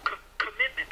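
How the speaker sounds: mock stutter on co- before commitment; playfully stuttering over the word commitment; stuck on commitment. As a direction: stammer co- co- co- then push out commitment. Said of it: "They can't say it".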